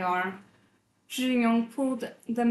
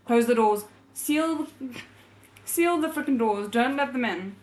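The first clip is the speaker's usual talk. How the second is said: shouting; 5 dB louder than usual. A narrator's reading. Zhi Jingyong orders the crowd to seal the doors, shouting. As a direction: bark orders over the chaos. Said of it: "then shouted at the assembled crowd".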